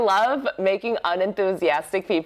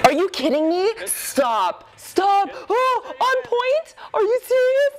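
shrieking disbelief; 7 semitones higher, slower than usual. Real, not acted. Reacting to a dancer balancing on a bottle with shrieking disbelief.

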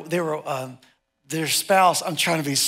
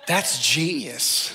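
amused; 4 semitones lower, much slower than usual. Retold or imitated. Amused aside.

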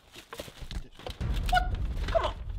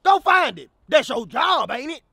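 loud, pitched-up angry shouting; angry yell; 5 semitones higher, 7 dB louder than usual. Acted. Customer yelling at the drive-thru worker, angry and loud. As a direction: bark it at the cashier.

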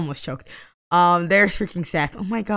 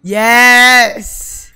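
loud, high, drawn-out celebration; triumphant cheer; 5 semitones higher, 12 dB louder than usual. Real, not acted. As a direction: shout it out in victory. Celebrates the proposal.

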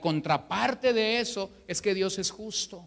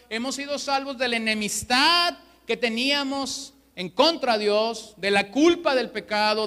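higher, louder sermon voice that builds through a list; preaching at full force; 4 semitones higher, 4 dB louder than usual. Acted. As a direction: drive each "de..." home to the congregation. (predicando con fuerza)

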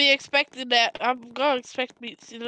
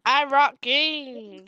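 slow drawn out; much slower than usual. Pretend. Slow dramatic gamer tag.

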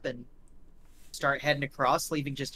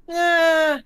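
high loud drawn-out eh; cocky shrug; 14 semitones higher, 9 dB louder than usual. Acted. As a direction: toss it off like a brat. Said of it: "He goes".

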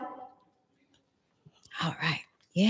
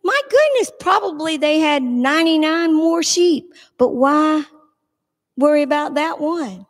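wondering listener; 6 semitones higher than usual. Retold or imitated. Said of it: "You think".

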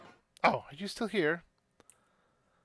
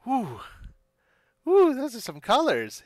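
excited whoop; 6 semitones higher, 7 dB louder than usual. Acted. Excited shopkeeper.

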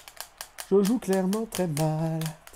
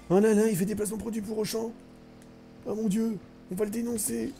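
higher, hammed-up scandalized gasp; mock outrage; 6 semitones higher than usual. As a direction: play the shocked fan. Mock outrage at sponsored content. (fausse indignation outrée)

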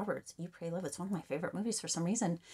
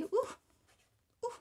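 excited squeal. Real, not acted. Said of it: "got so excited there".

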